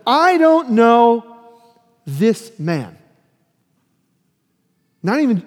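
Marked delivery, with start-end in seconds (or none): 0.1-3.0 loud, slow, drawn-out oath of denial; mock sworn denial, louder and slowed; swearing denial. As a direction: swear it out loud, dragging the words. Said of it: "And he swore them".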